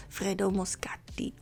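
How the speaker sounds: whispered Italian name. Shown as a demonstration. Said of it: "I tried in an Italian accent".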